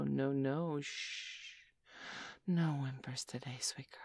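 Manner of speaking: ordinary delivery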